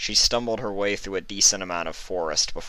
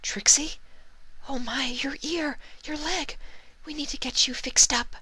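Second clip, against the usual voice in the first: timid Pegasus. A narrator's reading. Pegasus tending Trixie's wounds, timid high whisper. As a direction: fuss over her wounds in a tiny voice.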